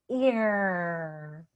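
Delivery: long "ear", gliding from ee into a held r; smile, then pull the tongue back through "ear"; stretched ear sound. Shown as a demonstration. Coach drilling the ear sound, stretched "ear". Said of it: "It goes".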